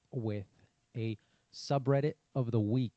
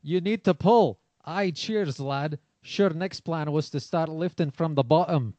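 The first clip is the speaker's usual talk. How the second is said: loud, higher mock-Scottish voice; Scottish accent; 5 semitones higher, 9 dB louder, slower than usual. Shown as a demonstration. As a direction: belt it out in a thick Scottish accent. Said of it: "I like that accent".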